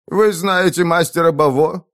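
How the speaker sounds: gurgling, bubbling voice; gurgling alien voice. Acted. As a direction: gurgle the question out. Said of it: "пробулькал вдруг управляющий". (булькающим голосом)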